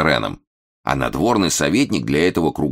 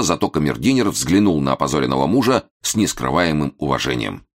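ordinary delivery

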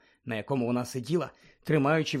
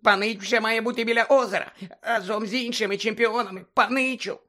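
Dobby the house-elf squeaking at Harry; squeaky house-elf; 8 semitones higher, 3 dB louder than usual. Acted. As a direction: squeak it like an anxious little elf. Dobby urging.

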